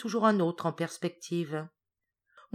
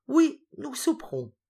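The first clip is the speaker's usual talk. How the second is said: much higher, relishing the supper; gleeful promise; 9 semitones higher, 5 dB louder than usual. A narrator's reading. Hippel patting his belly about supper, gleeful and high. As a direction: savour the words like a glutton. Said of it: "en se caressant le ventre".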